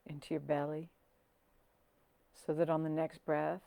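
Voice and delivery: soft, unhurried meditation voice with long pauses; hushed, settling calm; guided meditation. Acted. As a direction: guide them gently down into the body.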